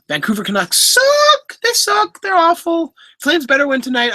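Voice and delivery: trash-talk the rival team, playful; mock hockey rant. Real, not acted.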